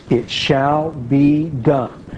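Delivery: "shall be done" drawn out word by word; very slow, weighty stress on each word of "shall be done"; emphatic. Real, not acted. Preacher reads the promise from scripture, emphatic. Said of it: "We can't emphasize that too much".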